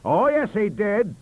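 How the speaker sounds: loud, high, slow insistence; shouting. Acted. Stacey shouting at Bissell, loud and slow. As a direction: bellow it back. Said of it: "shouted Professor Stacey".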